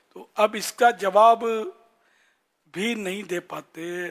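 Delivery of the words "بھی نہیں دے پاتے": ordinary talk, nothing marked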